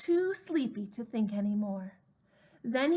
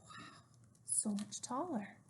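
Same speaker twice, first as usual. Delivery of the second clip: plain reading voice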